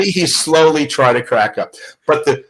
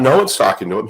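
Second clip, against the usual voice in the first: usual speaking voice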